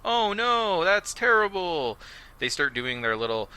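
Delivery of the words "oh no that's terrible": high, hammed-up fake dismay; high, mock worried villain. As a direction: overplay the concern. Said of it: "retold reply".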